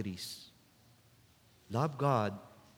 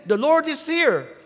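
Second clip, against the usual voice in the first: much higher and louder, bursting with the news; excited announcement; 13 dB louder than usual. Retold or imitated. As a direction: call it out like the best news ever, loud and high. Martha runs home to tell Mary.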